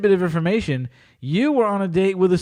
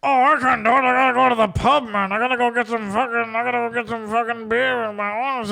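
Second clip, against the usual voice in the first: higher, drawn-on mock whine; mocking Eddie; 5 semitones higher than usual. Host imitating Eddie wanting the pub, mocking high voice. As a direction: imitate a pub-bound buddy in a raised put-on voice. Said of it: "he was gonna be like".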